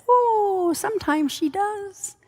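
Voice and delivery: high, drawn-out syrupy defender voice; lightly sing-song the excuse, slowly; mock sweet friend. Colleague covering for Lisa in front of the team, high mock-sweet voice. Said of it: "in a misguided attempt to be Lisa's B F F".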